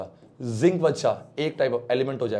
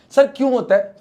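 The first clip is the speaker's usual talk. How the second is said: high, louder mock student voice; student asking; 9 semitones higher, 6 dB louder than usual. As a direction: play a curious pupil asking sir. Teacher voicing a student's question. (बच्चे की नकल में ऊँची आवाज़ में सवाल)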